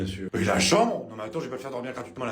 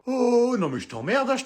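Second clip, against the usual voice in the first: offended outburst; 11 semitones higher, 3 dB louder than usual. Acted. Mother insulted by her daughter about makeup, high indignant retort. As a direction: fire it back, outraged.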